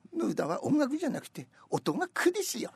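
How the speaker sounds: high mock impression of the singer. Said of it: "とかって言ってたじゃん".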